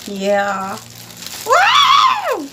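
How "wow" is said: very high, loud squealed wow; high thrilled shriek. Real, not acted. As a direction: squeal with delight. Seeing gift.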